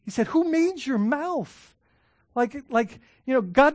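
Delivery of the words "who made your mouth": slow, much higher, indignant question; a stern, drawn-out rebuke; angry God. Retold or imitated. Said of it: "the Lord got angry".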